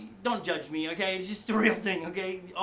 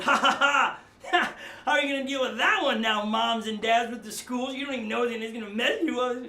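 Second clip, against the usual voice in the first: a gloating, uncaring God laughing at your trouble; mocking aloof God; 2 semitones higher, 5 dB louder than usual. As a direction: sneer down at the struggling parents like a smug bully. Mocking uncaring God, arms crossed.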